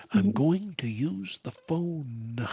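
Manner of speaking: slow, over-enunciated "I'm going to use the phone"; extreme pronunciation. Shown as a demonstration. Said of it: "extreme pronunciation".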